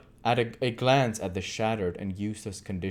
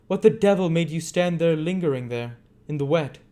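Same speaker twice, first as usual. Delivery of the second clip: higher, louder scolding; irritated Monks; 9 semitones higher, 7 dB louder than usual. A narrator's reading. Monks scolding Bumble for lingering in the rain, loud. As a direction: scold them for dawdling.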